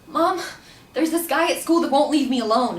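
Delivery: retold distressed whine for help; upset complaint. Daughter to mom about a stalker, loudly upset.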